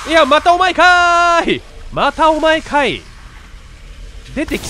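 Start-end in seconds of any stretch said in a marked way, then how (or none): 0.1-3.0 comic retort. Real, not acted. Jokey retort to a ghost.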